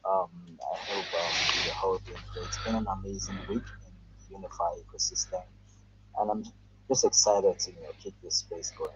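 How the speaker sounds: hoarse, sore throat voice; speaking through a sore throat. Real, not acted. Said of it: "I have terrible sore throat".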